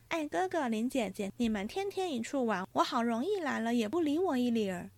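cheerful teasing. Acted. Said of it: "湘云走来笑道".

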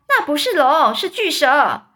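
loud insistent correction; excited shout. A narrator's reading. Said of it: "又有人说".

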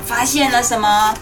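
usual speaking voice

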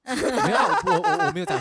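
high laughing denial; laughing protest. Real, not acted. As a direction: laugh while backing off. Defending herself, laughing.

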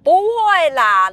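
mock-casual brush-off, high and drawn out; lightly voicing mom shrugging it off; 敷衍的妈妈. 妈妈随口敷衍医生. (模仿妈妈拖长音敷衍)

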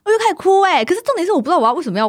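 high, loud and amazed; excited retelling. Real, not acted. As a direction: tell it still stunned. Recalls crying under hypnosis.

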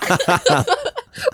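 high squealing laugh. Real, not acted. Reacting to the funny story. (高声尖笑)

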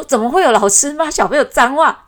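ordinary delivery

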